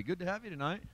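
ordinary talk, nothing marked

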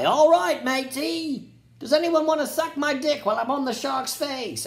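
lightly voiced British accent, swaggering; mock British accent. Statham agrees to ride the shark in a cocky mock British accent. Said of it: "have a British accent".

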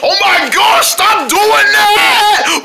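high, loud, drawn-out complaint; exasperated yell. Acted. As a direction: yell at them to stop. Exasperated after blast.

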